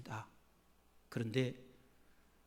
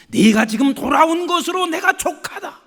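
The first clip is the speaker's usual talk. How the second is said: laughing loud; 8 semitones higher, 12 dB louder than usual. Retold or imitated. Voicing a forgiving God.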